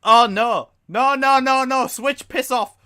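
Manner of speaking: high, loud panicked protest; alarmed. Real, not acted. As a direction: cry out in dismay. Reacting to chat message, alarmed.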